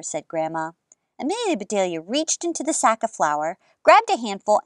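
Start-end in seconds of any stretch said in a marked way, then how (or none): none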